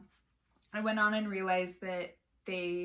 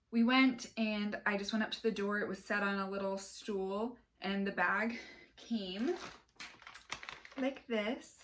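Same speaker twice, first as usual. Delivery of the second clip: out of breath. Real, not acted. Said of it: "Sorry, I'm a little out of breath too".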